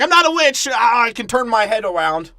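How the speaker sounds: put-on high, loud screech of a cursed girl; shrill mock witch. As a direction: shriek it out, mocking her. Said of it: "be like".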